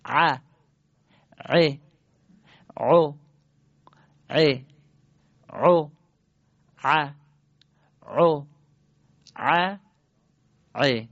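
ع and عو thick from the throat, one by one; isolated Arabic ayn syllables ع, عو, with a pause after each; ayn drill, singly. Shown as a demonstration. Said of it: "اول تک تک جواب بدید".

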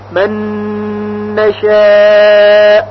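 نشاء with a long held humming ن; من نشاء merged into a slow nasal ن; long nasal ghunna. Shown as a demonstration. Teacher demonstrates the merge to students, held hum.

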